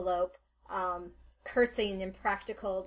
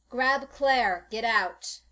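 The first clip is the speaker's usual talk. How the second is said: raised, loud command; urgent order; 3 semitones higher, 8 dB louder, much slower than usual. Acted. Adelaide answering Jacques during the escape, loud urgent order.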